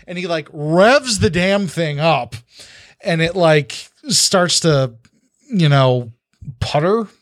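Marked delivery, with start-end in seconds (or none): none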